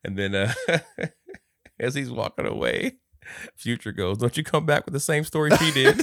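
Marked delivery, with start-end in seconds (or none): 4.2-6.0 raised, louder scolding in a retelling; mock scolding. Retelling Future warning as he walks away, mock scolding. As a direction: imitate a telling-off with laughter at the end.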